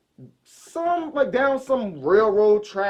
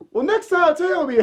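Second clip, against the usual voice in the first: high, raised-voice protest; indignant comeback; 13 semitones higher, 5 dB louder than usual. Retold or imitated. Teller scolding his roommate after the long walk, indignant. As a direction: replay a huffy complaint with the pitch way up.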